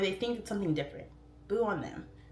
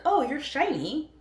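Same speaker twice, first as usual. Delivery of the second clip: high, slow, loud marvel; welcoming a strange new friend, higher and drawn out; delighted wonder; 9 semitones higher, 7 dB louder, slower than usual. Retold or imitated. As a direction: admire them like a bright treasure.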